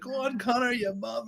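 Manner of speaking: high-pitched egging-on voice; mock cheering. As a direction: imitate a fan urging him on. Imitating someone cheering McGregor on.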